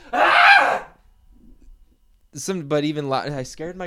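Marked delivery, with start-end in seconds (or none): 0.1-0.9 sudden loud high scream of cringe; embarrassed scream. Retold or imitated. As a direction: scream the thought out. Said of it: "I just go".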